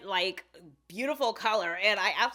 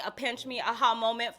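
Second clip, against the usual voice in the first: usual speaking voice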